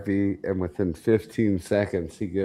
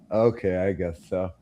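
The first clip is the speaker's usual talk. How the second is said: sheepish. Retold or imitated. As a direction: give in, embarrassed. Sheepish patient gives in.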